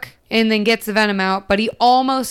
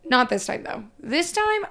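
normal talking voice

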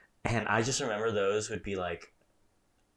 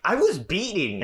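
high, louder indignant protest; indignant shout; 10 semitones higher, 8 dB louder than usual. Retold or imitated. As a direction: protest loudly like a sore kid. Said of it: "And I'm like".

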